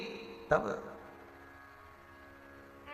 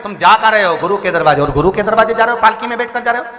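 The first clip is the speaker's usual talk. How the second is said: louder, higher, insistent rebuke to a king; raised-voice saintly admonition; stern admonishing; 5 semitones higher, 6 dB louder than usual. Retold or imitated. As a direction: hammer out each instruction like a preacher. Saint lectures the king in retold story.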